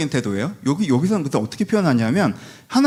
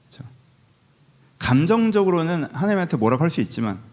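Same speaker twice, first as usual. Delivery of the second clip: ordinary delivery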